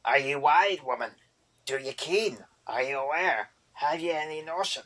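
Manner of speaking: loud goblin brogue, ranting; angry boggart. A narrator's reading. Boggart rants at Erder about the cream, angry goblin brogue. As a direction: scold her like a cranky goblin.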